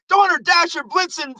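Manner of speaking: high-pitched frantic mock blurting; crazed. Frantic dad naming reindeer to win the contest, mock crazed. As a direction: imitate a crazed contestant rattling off names. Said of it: "all crazy face".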